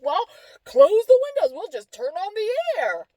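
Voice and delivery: high, loud imitated retort; mock someone's clueless solution; argumentative suggestion.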